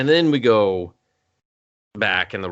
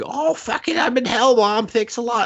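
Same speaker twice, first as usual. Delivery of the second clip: high, mocking teen girl; a hammed-up high girl on the phone; mock daughter voice; 12 semitones higher than usual. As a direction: squeak it like a bratty daughter.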